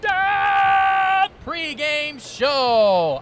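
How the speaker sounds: drawn-out announcer call; announcer shout. Acted. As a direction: belt out the show name slowly. Host announcing show title.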